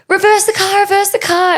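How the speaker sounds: high, urgent mock yell; retold panic. Passenger ordering the driver off him, acted panic. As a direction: call out a frantic order, pitched high.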